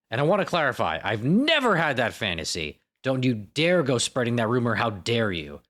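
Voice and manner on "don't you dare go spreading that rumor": ordinary delivery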